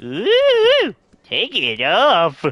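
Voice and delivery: high ooh, then slow drawn-out tease; flirty mock-sultry line; teasing. Teasing about stripping the bust.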